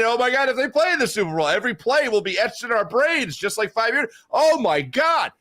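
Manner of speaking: high-pitched, escalating comic panic; mock freak-out. Sports host imagining every Super Bowl play, freaking out. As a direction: play up a spiraling fan's panic. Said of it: "Okay, I'm freaking out".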